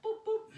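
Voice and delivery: two high, light boops; high-pitched cartoony hop noise; playful boop sounds. Shown as a demonstration. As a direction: boop along with a little hop. Imitates a little jump back.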